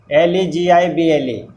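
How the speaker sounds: each letter of लेजिबल spelled out slowly; spelling out letters. Shown as a demonstration. Teacher spelling a word for students, letter by letter. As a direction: dictate एल ई जी आई बी एल ई letter by letter. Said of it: "लेजिबल".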